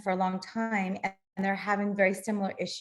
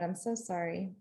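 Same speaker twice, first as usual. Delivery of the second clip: quiet apology; 2 semitones lower, 8 dB quieter than usual. Real, not acted. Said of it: "I'm having technical problems".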